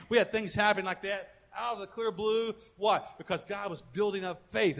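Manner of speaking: ordinary talk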